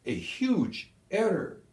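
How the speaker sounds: slow, high, pointed verdict; stern verdict. A narrator's reading. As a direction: let each word land slowly. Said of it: "Kesselring was already smirking".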